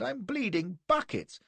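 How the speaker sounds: high, drawn-out complaint; hammed-up moaning; pained groan. A narrator's reading. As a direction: wail it slowly like a wounded man wanting sympathy. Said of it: "I groaned".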